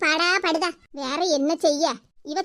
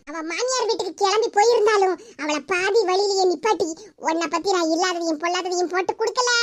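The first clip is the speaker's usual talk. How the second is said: ordinary delivery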